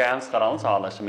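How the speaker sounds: ordinary talk, nothing marked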